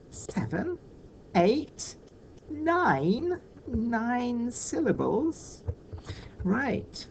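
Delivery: rising in pitch as if the total is going too far; mock surprise. Shown as a demonstration. Teacher counting too many syllables for pupils, mounting mock surprise. Count runs past the allowed seven.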